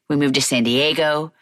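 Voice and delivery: ordinary talk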